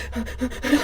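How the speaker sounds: quick, high gasps of mock panic; mock panicked gasps. Retelling her reaction to a friend's warning look, mock panicked gasps. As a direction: imitate panicky gasping. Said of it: "You're making me panic".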